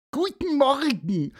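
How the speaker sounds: hohe, langsame Vogelstimme; kleiner Vogel. Acted. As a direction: neugierig und hoch als kleiner Vogel fragen. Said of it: "sagte auch Pieper". (Pieper fragt Antonius, hohe Vogelstimme)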